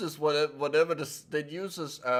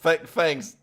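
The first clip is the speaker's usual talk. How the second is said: usual speaking voice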